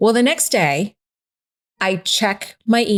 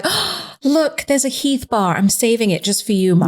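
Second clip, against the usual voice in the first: higher voice with a delighted gasp; excited child, imitated; 3 semitones higher than usual. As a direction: imitate a kid proudly showing Mom a find. Mother voices her excited daughters.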